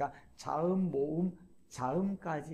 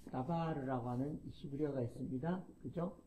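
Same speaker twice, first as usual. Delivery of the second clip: ordinary talk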